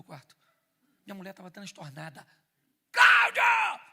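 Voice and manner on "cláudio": much higher, much louder scream of his name; furious shout. Retold or imitated. Distraught wife screaming at her husband.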